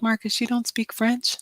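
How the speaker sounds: a mother's baffled question to her son; surprised mom. Retold or imitated. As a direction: pitch jumps up in mock disbelief. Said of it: "I'm like".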